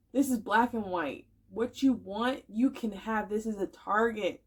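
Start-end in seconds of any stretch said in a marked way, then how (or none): none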